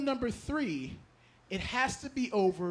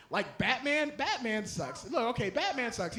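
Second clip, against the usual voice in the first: ordinary talk, nothing marked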